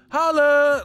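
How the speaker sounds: high loud yelp; shouted holla. Retold or imitated. Comic imitating the song's ad-lib, loud high shout. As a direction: belt it out like the hype call.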